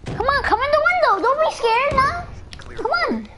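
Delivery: high taunting call. Real, not acted. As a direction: goad the enemy in. Taunting enemy at window.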